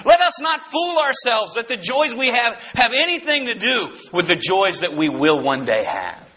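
ordinary talk, nothing marked

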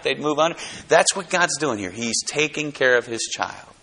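ordinary delivery

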